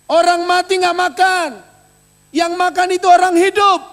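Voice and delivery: loud, high pulpit proclamation; preacher booming the promise at the church; fiery preaching. Acted. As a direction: thunder the promise to the congregation. Preaching at full force.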